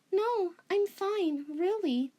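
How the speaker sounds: high, slow, forced reassurance; lightly voiced lying girl; forced fine. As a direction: pretend everything's fine. Reichiro hides something.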